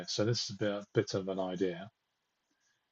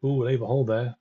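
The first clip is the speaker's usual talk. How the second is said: usual speaking voice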